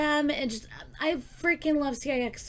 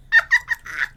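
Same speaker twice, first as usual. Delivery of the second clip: high laugh; 8 dB louder than usual. Real, not acted. Laughing at own mistake.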